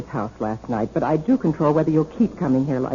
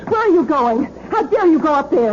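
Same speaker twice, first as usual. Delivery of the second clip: high, sharp and louder; outraged demand; indignant outburst; 10 semitones higher, 3 dB louder than usual. Acted. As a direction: try to stop him. Sister stops officer upstairs.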